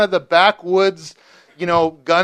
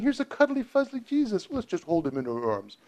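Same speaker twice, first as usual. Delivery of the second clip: mock cutesy; 5 semitones higher, 8 dB quieter than usual. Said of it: "John's saying".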